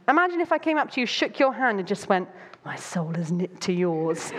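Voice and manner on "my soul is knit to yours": low, slow and solemn, a mock-earnest stranger; mock intense solemn vow. A stranger shakes your hand and vows friendship, low and solemn. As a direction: declare it with deadpan intensity.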